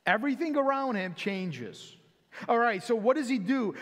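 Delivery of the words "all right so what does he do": normal talking voice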